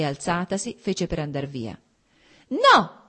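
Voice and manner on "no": sharp shout pitched much higher and louder; one shrill, loud No. A narrator's reading. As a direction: stop her with a piercing cry. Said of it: "gridò lui con la sua voce stridula di un tono più alto del normale".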